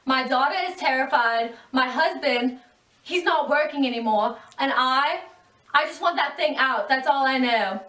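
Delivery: distraught mother. Acted. Terrified Mrs Samsa interviewed.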